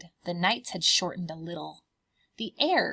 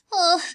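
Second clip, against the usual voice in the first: scared ugh; 10 semitones higher, 10 dB louder than usual. Acted. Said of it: "The cubs trembled".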